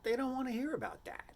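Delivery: high-pitched mock objection to advisors; acted protest. Speaker answering his advisors back, high-voiced mock protest. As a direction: push back in an exaggerated high voice. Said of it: "And I was like".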